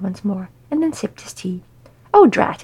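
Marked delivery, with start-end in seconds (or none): 2.1-2.6 surprised dismay. Acted. Tea gone cold, sudden dismay.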